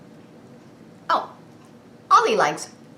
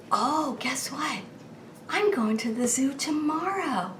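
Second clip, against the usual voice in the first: stage whisper; 6 semitones higher, 6 dB quieter than usual. Acted. Secret for the child.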